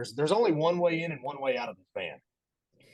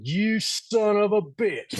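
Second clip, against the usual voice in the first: angry quote; 8 semitones higher, 4 dB louder, much slower than usual. Retold or imitated. Angry coworker on phone call.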